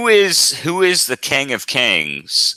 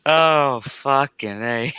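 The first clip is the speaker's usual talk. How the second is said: tipsy giggling fit; 6 semitones higher, much slower than usual. Real, not acted. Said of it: "if I'm laughing".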